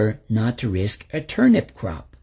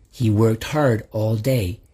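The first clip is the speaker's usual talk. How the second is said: ordinary delivery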